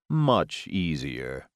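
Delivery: low, slow vampire pronouncement; the vampire gloating as he turns toward the baby; smug proclamation. A narrator's reading. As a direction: declare it slowly, pleased with himself. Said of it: "he proclaimed".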